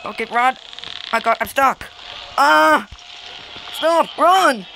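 loud, high frantic yells; panicked yelling. Real, not acted. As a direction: shout in frightened panic. Stuck while being chased.

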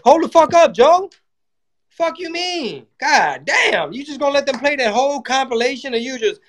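outraged disbelief. Retold or imitated. Comic yelling at Joe in high shocked outrage. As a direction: squeal it like you can't believe it. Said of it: "I was like".

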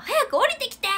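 high, loud yell down the stairs; retold yell. Captain yelling at juniors to come down, loud and high. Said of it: "叫んで".

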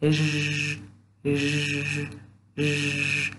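ژ held as a soft zhh buzz; isolated zh sound. Shown as a demonstration. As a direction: sustain ژ like the s in measure. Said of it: "چنین تلفظ می شود".

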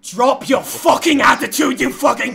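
high, loud tirade; angry shouting. Real, not acted. Gamer berating a party member for his attitude, angry shouting. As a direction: tear into them at full shouting volume.

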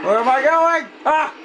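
high, loud frantic shout; panicked shriek; panicked yelling. Real, not acted. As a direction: yell it, lost and being chased. Lost while being chased.

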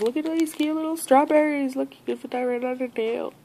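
high-pitched cooing excitement; gushing delight. Real, not acted. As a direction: squeal over something tiny and cute. Gushing over tiny strawberry pieces.

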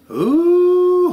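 high delighted ooh. Real, not acted. Delighted food exclamation.

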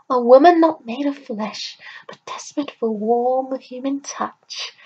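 high, loud, then dropping to a breathy whisper; old crone tempting a lonely man, loud then whispering; sly old crone. Acted. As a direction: lure him in, then lean close and whisper.

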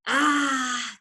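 loud, drawn-out "ah"; satisfied gasp. A narrator's reading. Smallest fox after fiery cider, gasping with pleasure. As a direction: let out a big gasp after drinking. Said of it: "gasped the smallest fox".

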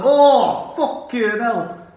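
loud, high, drawn-out Bolton exclamation; Bolton accent. Retold or imitated. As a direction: cry out in pain in broad Bolton. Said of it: "said it in a Bolton accent".

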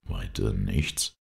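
low, drawn-out question; low measured question. A narrator's reading. Hero questions Bob about what he saw, low and slow. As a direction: ask slowly, pitched well down.

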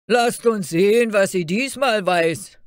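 loud, high, mocking watchman; another guard's voice. A narrator's reading. Another guard puts Fairy Tale to the test, loud challenge. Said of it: "sprach ein anderer".

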